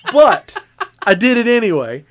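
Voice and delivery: ordinary talk, nothing marked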